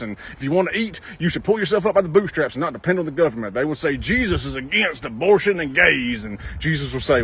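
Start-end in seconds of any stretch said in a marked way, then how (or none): none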